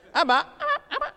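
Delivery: mock parrot saying Abba. Shown as a demonstration. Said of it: "You could teach a parrot to say the word".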